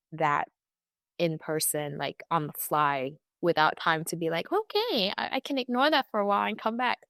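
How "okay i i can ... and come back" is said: high-pitched, quick put-on okay; mock breezy brush-off. Widow imagining brushing off condolences at work, mock breezy. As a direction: voice a breezy coping act, pitched up. Voices shrugging it off to oneself.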